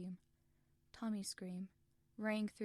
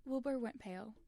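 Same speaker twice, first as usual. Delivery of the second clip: ordinary delivery, nothing marked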